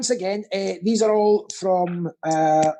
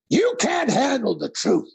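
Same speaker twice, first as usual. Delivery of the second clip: slow, hammed-up courtroom outburst; dramatic movie-quote delivery; 5 semitones higher than usual. Quizmaster hamming up a famous film line, slow outburst. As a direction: draw it out with theatrical force. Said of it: "It can only be said like that".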